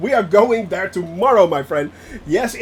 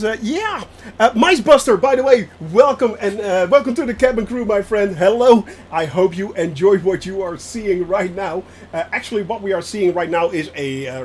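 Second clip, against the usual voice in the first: normal talking voice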